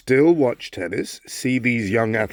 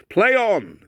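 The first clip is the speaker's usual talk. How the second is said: high, ringing umpire announcement, louder; umpire's call; 7 semitones higher, 3 dB louder, much slower than usual. Pretend. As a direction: call it out like a Wimbledon umpire opening the match. Umpire's call to start play.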